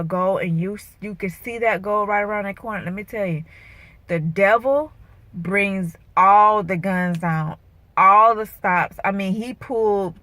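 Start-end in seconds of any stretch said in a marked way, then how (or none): 4.1-9.0 emphatic warning. Real, not acted. Warning about the devil.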